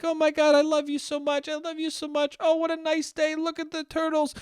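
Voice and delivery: high-pitched mocking lovebird voice; lovey-dovey couple. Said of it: "looking at a happy couple look at the turtles and be like".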